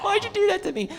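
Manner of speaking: high, squealed mock reproach; mock anguish. Fan to the dub actor who made them cry, mock anguish. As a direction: wail at the one who made you cry. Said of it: "I was just like".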